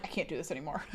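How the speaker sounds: low, quiet imitation of giving up; mock frustrated. Boyfriend giving up on teaching Greek, imitated in quiet frustration. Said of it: "Or he gets frustrated".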